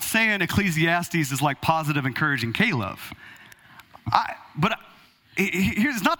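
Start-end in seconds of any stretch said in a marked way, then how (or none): none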